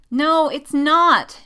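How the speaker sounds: high, loud, slow wail; lamenting. A narrator's reading. Jessica laments her crush to Poe, wailing. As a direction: draw it out in dramatic despair. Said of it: "Jessica laments".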